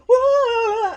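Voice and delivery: loud, high drawn-out cry of alarm; panicked whoa. Pretend. Warning viewers they may run off camera, mock alarmed cry. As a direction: shout a sudden long "whoa". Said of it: "if we suddenly go".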